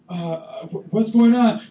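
slow, loud bewildered question; mock bewildered listener; confused. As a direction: play a baffled listener.

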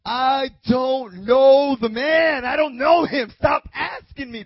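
shouted, high, insistent protest; vehement denial. Acted. Peter swearing to those standing there, vehement shout. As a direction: yell it to shut the questioners up. Acting out Peter cursing, denying Jesus.

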